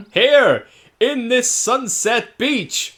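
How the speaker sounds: high, loud and slow theatrical proposal; grand mock proposal. Proposing to the creature on a sunset beach, hammed-up grand. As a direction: propose like a hammy romantic hero.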